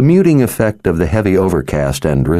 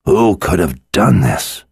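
half-whispered question, voiced rather than hushed; a stage whisper with some voice in it; intense whisper. A narrator's reading. Victor asks who could have done this, half-whispered. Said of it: "Victor whispered".

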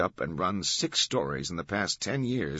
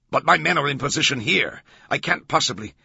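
loud, raised-pitch objection; protesting; 8 semitones higher, 8 dB louder than usual. A narrator's reading. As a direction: object hotly and get cut off. Commander protesting an urgent order.

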